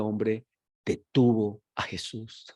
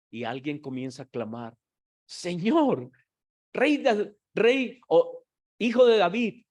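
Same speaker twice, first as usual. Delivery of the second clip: impassioned preaching into a cry; 7 semitones higher than usual. Acted. Preacher reenacting the blind man crying to Jesus, impassioned shout. Preacher then voicing the clamor.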